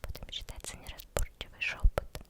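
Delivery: ordinary talk, nothing marked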